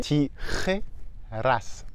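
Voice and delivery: tijeras very slow, throaty j and tapped r; slow model word. Shown as a demonstration. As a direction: draw out tijeras sound by sound. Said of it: "il faut pouvoir le prononcer".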